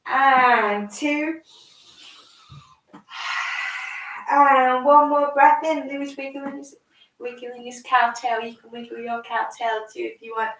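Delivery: slow, gentle yoga cueing; calm instructions for a small child; reading to a toddler. Acted. Guides a child through yoga poses.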